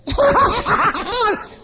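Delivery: high, loud whooping laugh of a happy man; imitated big laugh. Preacher imitating his friend's blessed laugh. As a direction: let out his joyful laugh. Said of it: "and he laughed".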